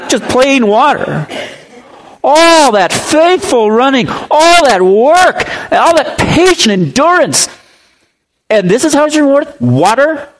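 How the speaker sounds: high, slow, hammed-up indignation at mere water; mock outrage. Runner who got only water, high slow mock outrage. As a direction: wail it like the betrayed runner, drawn out and high.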